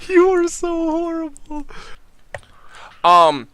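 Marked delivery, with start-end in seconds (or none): 0.1-1.7 amused teasing through laughter, higher pitch; laughing. Real, not acted. Game master laughing at a player's in-character line. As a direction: tease the player while cracking up.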